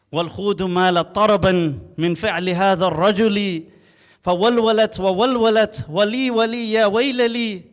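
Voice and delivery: fast, loud, rising chant of Arabic verse; rapid poem recitation. Acted. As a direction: rattle off the poem like a showman poet, drums and all. Performs the tongue-twister poem at court.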